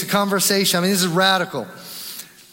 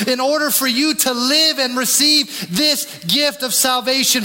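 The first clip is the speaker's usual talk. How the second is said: preaching at full force; 5 semitones higher than usual. Acted. Pastor voicing Jesus to the crowd, rising preaching fervor. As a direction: drive the promise home to the congregation.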